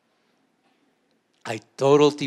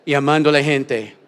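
louder preacher's call-and-response line; 9 dB louder than usual. Shown as a demonstration. Pastor calling out a line for the church to repeat, louder.

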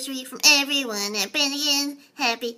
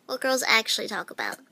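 usual speaking voice

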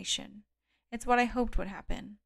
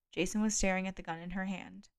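ordinary delivery

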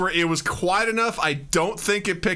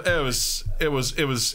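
usual speaking voice

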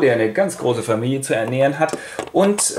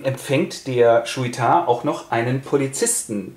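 ordinary talk, nothing marked

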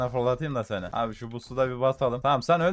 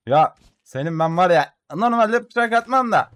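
loud, high, slow furious yell; angry shout; 5 semitones higher, 8 dB louder than usual. Real, not acted. Gamer yelling at the enemy he just knifed, loud and high. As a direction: bellow it at the enemy who wore you out.

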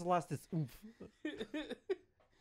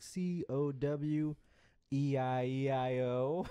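spelling it out; much slower than usual. Retold or imitated. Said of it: "he's like".

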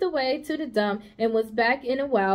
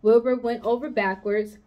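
ordinary delivery, nothing marked

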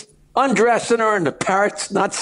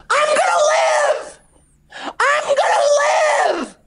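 very high, slow squawked cry; drawn-out parrot shriek. Acted. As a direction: wail it triumphantly like a surviving parrot. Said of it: "all of a sudden she hears".

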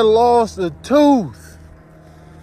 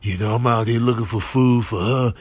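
deep creature voice; 8 semitones lower than usual. Acted. Crammed complains about Darlene and Ramon in a deep voice. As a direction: rumble it deep like an upset beast.

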